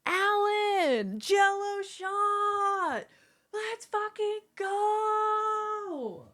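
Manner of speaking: high, stretched out excited yell; hype cheer. Real, not acted. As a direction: cheer them on like a party host. Cheering viewer, Jell-O shot.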